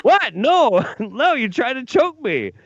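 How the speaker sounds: laughing protest. Retold or imitated. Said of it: "retelling own protest".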